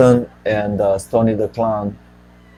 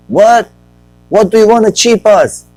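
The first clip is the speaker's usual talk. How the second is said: high, loud, disbelieving shout; outraged locals; 12 semitones higher, 8 dB louder than usual. Retold or imitated. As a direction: yell it in shocked outrage. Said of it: "locals react, acted".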